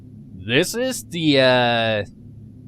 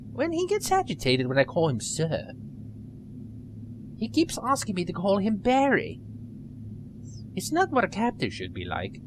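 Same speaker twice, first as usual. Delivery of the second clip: put-on nerdy girl voice; 4 semitones higher, 4 dB quieter than usual. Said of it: "I gave her a little nerdy voice".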